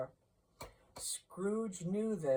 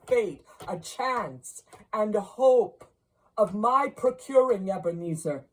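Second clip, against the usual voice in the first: louder, higher, insistent ghost; urgent ghostly warning; 4 semitones higher, 8 dB louder than usual. A narrator's reading. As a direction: press the warning home.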